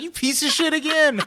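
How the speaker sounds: high-pitched, laughing accusation; playfully outraged squeal at being fooled; mock outrage. Teller quoting comeback to bartender, mock outraged.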